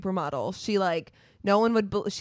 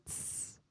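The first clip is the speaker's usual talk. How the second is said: hiss sound; 25 dB quieter than usual. Pretend. Mock sound.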